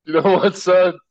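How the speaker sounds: high, loud mock King George yell; mock outburst. King George shutting down the colonists' money, in a loud high mock shout. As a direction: bark it out like an offended king. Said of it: "He goes".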